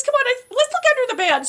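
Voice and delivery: high, quick hammed-up urging; improvised stage wife. Actress improvising to hide forgotten lines, high hurried urging. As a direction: hustle a husband along, pitched high. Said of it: "I says".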